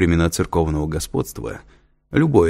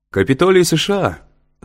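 loud, high-pitched classroom call-out; shouted group answer; 11 semitones higher, 6 dB louder than usual. A narrator's reading. Students answering the lecturer's question all together, shouting. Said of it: "хором прокричало несколько десятков человек".

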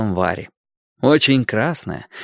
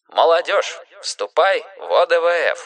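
high-pitched proclaimed poster slogan; 6 semitones higher than usual. A narrator's reading. Boy reading his poster's slogan, proclaimed. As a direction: proclaim it like a rallying call.

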